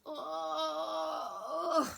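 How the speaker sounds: loud, high pretend moan; sick moan. Shown as a demonstration. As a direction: moan as if feeling awful. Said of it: "Can you let out a moan like that?".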